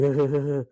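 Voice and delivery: weird little mock chuckle; imitated weird laugh. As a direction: imitate someone laughing oddly. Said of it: "he's, like, laughing weirdly".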